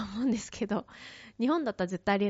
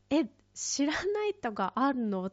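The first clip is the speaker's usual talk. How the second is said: higher, slowed, mock-stunned "え"; stunned disbelief; 6 semitones higher, slower than usual. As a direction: replay your own disbelief, drawn out. Shocked by the driver.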